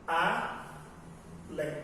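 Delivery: ALÉM said slowly as A, pause, LÉM; a long gap between A and LÉM in ALÉM; split into syllables. Shown as a demonstration. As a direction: break ALÉM into two separate syllables. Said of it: "separar a palavra silabicamente".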